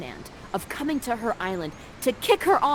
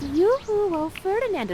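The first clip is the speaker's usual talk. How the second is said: high, singsong yoo-hoo; flirty calling; 10 semitones higher than usual.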